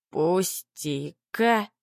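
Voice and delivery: put-on very slow, slurred drunken mumble; town drunk. A narrator's reading. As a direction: drag it out like a drunk. Drunk Sancho pulls his arm free.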